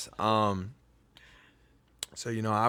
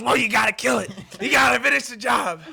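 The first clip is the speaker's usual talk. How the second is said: high, raised retold mom voice; mom's command; 10 semitones higher, 4 dB louder than usual. Mom ordering her kid to finish the mole, insistent mock shout. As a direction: imitate a mother urging her kid on. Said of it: "She goes".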